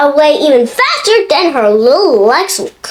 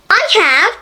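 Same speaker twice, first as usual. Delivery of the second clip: a small, higher piping voice; piped up; 6 semitones higher than usual. Acted. Little Mister Small chiming in at the meeting, piping. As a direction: pipe up eagerly. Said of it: "piped up Mister Small".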